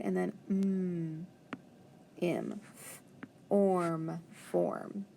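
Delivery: mm, orm, form blended softly; teacher blending form; sound-out blend. Shown as a demonstration. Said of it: "the word form".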